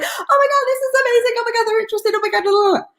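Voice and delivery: high, breathless mock gushing; overexcited gushing. Mocking a rep who blurts out excited chatter. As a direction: gush like a newbie who can't stop talking. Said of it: "you get so excited".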